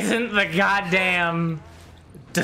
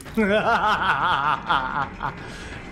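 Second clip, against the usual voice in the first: long rattling villain laugh; playful evil cackle; drawn-out cackle. Pretend. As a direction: cackle slowly in separate pulses. Cackles as the Hag in game.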